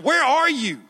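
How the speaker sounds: high, desperate plea; freaking out. Acted. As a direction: call out for someone missing. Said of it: "I'm freaking out".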